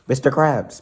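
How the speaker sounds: loud, high attention-getter; calling for attention. A narrator's reading. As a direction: call out to snap him out of it. Trying to break his money trance.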